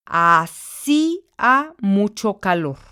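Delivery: Hacía said a-SÍ-a, slowly, í split from a; slow example. Shown as a demonstration. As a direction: stretch Hacía so the í is stressed apart. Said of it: "Es el mismo caso de Asia o hacía". (Hacía pronunciado lento con hiato)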